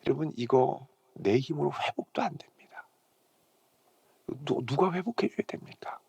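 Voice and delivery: hushed, intimate sermon whisper; whispered preaching. Acted. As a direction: draw the congregation in with a near-whisper. 설교 중 속삭이는 대목. (속삭이듯 낮게 전하는 설교)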